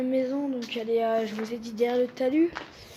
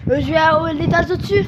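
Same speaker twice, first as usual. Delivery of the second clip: raised, higher voice over the wind; shouting over wind; 3 semitones higher, 8 dB louder than usual. Real, not acted. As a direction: call it out over the gusts. Calling over wind while climbing.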